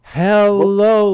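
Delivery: high, loud, stretched-out greeting; real nice and slow, as if for someone who can't follow; exaggerated slow speech. Shown as a demonstration. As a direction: draw it out condescendingly. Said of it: "say it real nice and slow".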